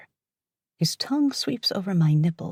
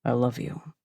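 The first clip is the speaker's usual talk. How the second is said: low male voice; 5 semitones lower than usual. Acted. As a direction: say it as the man, low and tender. Lover speaks, narrator voices him.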